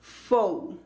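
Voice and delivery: FO drawn out as "fou"; diptongo O. Shown as a demonstration. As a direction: glide from o to u in FO. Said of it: "es con el diptongo O". (FO alargado, con diptongo "ou")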